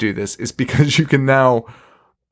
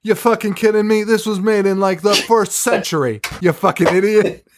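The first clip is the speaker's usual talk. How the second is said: mocking a condescending know-it-all, pitched higher; condescending occultist; 7 semitones higher than usual. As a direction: sneer at her like she's an idiot. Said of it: "the fucking occultist is like".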